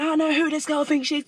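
high imitation of her ranting on the phone; mock screaming. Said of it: "You can hear her screaming".

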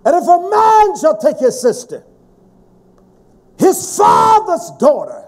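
loud, high preaching of the verse; preaching shout. Real, not acted. Preacher shouts the incest verse to show how vile it is. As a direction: cry the verse out with force.

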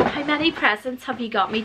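usual speaking voice

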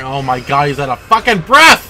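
loud, high yelling at the character; furious rant. Real, not acted. Player raging at Alan for running out of breath, furious shouting. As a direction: scream your hate at the useless hero.

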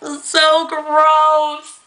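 high, very slow drawn-out disgust. Retold or imitated. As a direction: drag out every word in revulsion. Freaking out at the bug.

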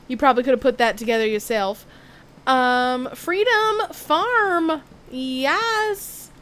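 hammed-up Southern accent. Streamer keeps up the gag while gaming, Southern accent. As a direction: put on a playful Southern twang. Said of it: "has to use a southern accent".

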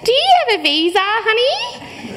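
a hint of a patronising cashier, honey rising high; lightly voiced condescending Dorothy; mock checkout lady. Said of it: "Her plastic tag read Dorothy".

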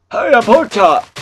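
a hint of a high, loud dopey character; badly faked character voice; Pork Chop voice. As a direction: try a goofy cartoon yell. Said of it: "I can't do the pork chop voice".

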